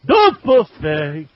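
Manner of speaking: slow, loud showman call; announcer voice. Acted. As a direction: belt it like a game show host. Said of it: "as I say every week".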